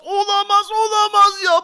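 loud, high cry of disbelief; hammed-up despair. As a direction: wail it out in mock despair. Second camera broken too. (abartılı isyanla haykırış)

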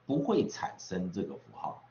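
usual speaking voice

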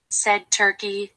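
ordinary delivery

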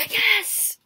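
thrilled yelp; excited yes. Real, not acted. Winning round excitement.

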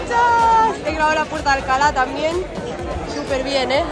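high, loud, breathless; buzzing right after finishing; excited. Real, not acted. Recién acabada, sin aliento. (emocionada y sin aliento)

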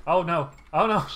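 loud alarmed cry of oh no; panicked yelling. Real, not acted. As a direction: yell it out in panic. Panicked in the game fight.

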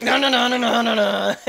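loud, laughing string of no's; mock yelling no. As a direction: bellow them off the seesaw while laughing. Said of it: "Till they came out and I'm like".

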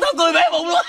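loud, high, gleeful; loud laughing boast. Acted. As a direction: crow it out laughing. Bragging loudly about laughing.

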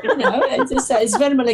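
high, sing-song mock posh British English; hammed-up British lady just waking in bed; mock posh British accent. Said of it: "nag-a-accent".

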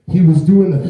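a burst of wild Muppet drummer noise; Animal noise. Retold or imitated. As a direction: imitate Animal's wild grunting laugh. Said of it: "thing that Animal does".

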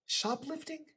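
high, disbelieving echo; shocked echo. A narrator's reading. Son repeating the charge, high and incredulous. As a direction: toss the word back in disbelief.

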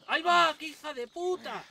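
high, loud shocked exclamation; startled shout. Real, not acted. Gamer ambushed by an enemy, high loud startled shout. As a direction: blurt out in sudden shock.

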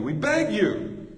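impassioned, slow, high "we beg you"; passionate plea. Shown as a demonstration. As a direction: beg them with real passion, stretching "beg". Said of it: "Feel the passion".

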